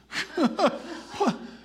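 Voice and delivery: high, breathy burst of laughter; short laugh. Real, not acted. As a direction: laugh it off after a tender story. Laughs after story of crying.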